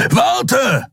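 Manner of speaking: high, urgent call; called after someone. A narrator's reading. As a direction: shout after someone running away, pitched high. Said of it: "rief Kero dem Menschen hinterher".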